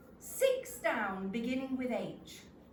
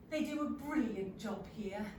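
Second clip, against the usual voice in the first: ordinary delivery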